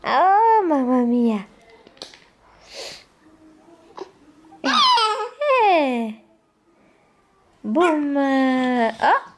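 high delighted exclamations; excited. Real, not acted. Excited play with baby.

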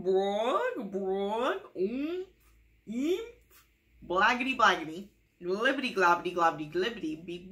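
loud, slow nonsense gibberish with squeaky leaps; hammed-up nonsense babble; silly sounds. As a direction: go wild with goofy gibberish noises. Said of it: "makes silly sounds".

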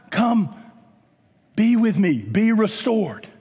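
calm, tender coaxing with long pauses; gentle invitation. Acted. Preacher as Jesus inviting sinners to breakfast, gentle and tender. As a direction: invite them in, unhurried and reassuring.

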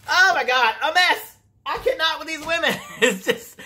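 loud, high, amused disbelief; real can't-believe-it laugh; laughing exasperation. Real, not acted. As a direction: exclaim it, breaking into laughter.

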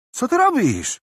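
high, loud startled yell. Acted. Thief yelling at Tarik who grabs him, high and loud. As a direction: scream it out as you're grabbed. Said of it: "wrzasnął chłopak".

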